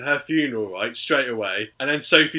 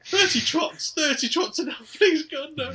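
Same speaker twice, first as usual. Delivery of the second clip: much higher, hammed-up frantic pleading; mock panic. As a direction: beg like a terrified passenger. Panicked plea in mock scene.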